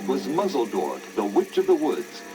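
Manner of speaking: plain reading voice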